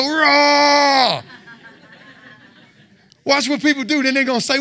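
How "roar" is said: long, loud high roar; big drawn-out roar; demonstrated roar. Shown as a demonstration. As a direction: roar it out for all to hear. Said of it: "Grab some rice or a canned good and go".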